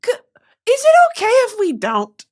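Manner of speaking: high, small, hesitant plea; timid refusal. Retold or imitated. Declining the fan's photo, high and timid. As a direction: politely back out, squeaking. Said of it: "I go".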